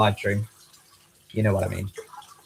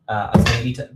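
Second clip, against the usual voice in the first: normal talking voice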